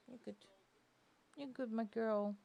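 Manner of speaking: ordinary delivery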